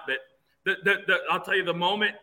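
ordinary delivery

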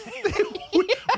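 short, high, rising laugh; a quick laugh breaking in. Real, not acted. Laughing mid-sentence.

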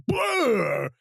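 a sudden high, loud hammed-up "blah"; mock scream. Recalling a tense movie moment, mock panicked yelp. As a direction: blurt it like a startled yelp.

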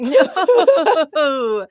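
laughing blunt no; deadpan no through a laugh; flat refusal with laugh. Retold or imitated. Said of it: "I'm like".

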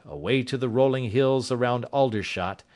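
usual speaking voice

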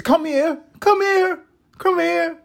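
put-on very high, piercing little-kid squeal; imitating toddler daughter. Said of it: "these little phrases that she'll throw up".

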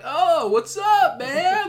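put-on high, slow, drawn-out California surfer accent; lazy Valley surfer greeting, drawn out high. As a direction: stretch it like a Valley dude. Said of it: "Valley Girl accent on California people".

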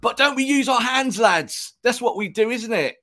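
hammed-up traditional goalkeeper pleading with the lads; old-school keeper. Pundit mocking old keepers pleading with teammates, high hammed-up voice. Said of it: "sit on that side of the goalkeeper union and go".

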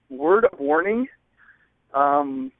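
ordinary delivery

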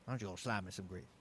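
low, soft Southern accent. Shown as a demonstration. Showing friends it's that easy, soft Southern accent. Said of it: "that's not bad".

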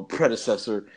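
ordinary delivery

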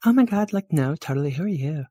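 put-on high girly gushing; high-pitched, flustered girl; gushing valley girl, curious. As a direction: greet a stranger as an excited valley girl.

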